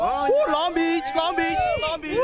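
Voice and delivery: high, loud repeated whooping chant; hometown cheer. Real, not acted. Dancers asked who they represent, excited high cheer.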